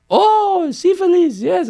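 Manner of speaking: high imitation of French girls' accent; mock French accent. French girls answering the boys, mock high French accent.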